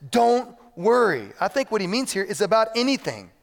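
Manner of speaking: high, driving list rising to a loud peak; forceful preaching. Real, not acted. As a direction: build momentum like a fired-up preacher.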